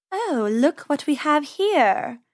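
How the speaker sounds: slow, silky purring cat voice. Acted. Cat purring at the rabbits she means to eat. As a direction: purr it like a cat eyeing supper. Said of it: "she purred".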